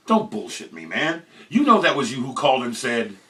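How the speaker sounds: ordinary delivery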